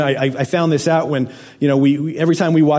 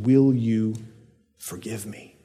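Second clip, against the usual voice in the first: hushed plea; 5 semitones lower, 6 dB quieter, slower than usual. Retold or imitated. Apology scene, asking for forgiveness.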